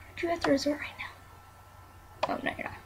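breathy hushed question; whisper. Real, not acted. Puzzled aside to the sim, whispering. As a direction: whisper it under your breath.

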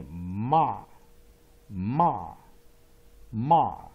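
"ma" with an open short-O "ah"; variant A as "ma". Shown as a demonstration. Teacher showing students how variant A is read, open drawn-out "ma". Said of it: "它念".

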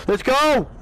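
high, excited rallying yell; excited shout. Real, not acted. Player shouting at AI teammates, excited shout. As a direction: shout it to fire up the squad. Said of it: "I know I'm shouting at A I".

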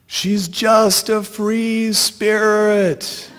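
high, long-drawn distant call; yelling. A narrator's reading. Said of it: "I'm yelling".

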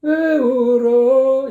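exaggerated parody of the bad take; mock wrong take. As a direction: overdo the singer's broken rhythm. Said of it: "이렇게 하고 있다고".

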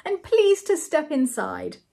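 slow, high-pitched invitation; good-natured. A narrator's reading. Butler invites the guests inside, good-natured. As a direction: usher them in slowly and heartily. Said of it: "he exclaimed good-naturedly".

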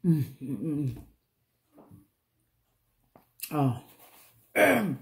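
talking with food in mouth; chewing food. Real, not acted. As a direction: mouth partly full. Seen 1: Lips pursed and working after corn bites; corn on plate beside.

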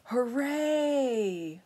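high, stretched-out cheer; villagers' shout. A narrator's reading. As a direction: cheer like a happy crowd. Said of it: "villagers shouting".

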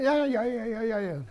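high-pitched run of repeated agreement; imitated dismissive chatter. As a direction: mimic aides babbling assent without understanding. Imitating stretcher bearers brushing him off.

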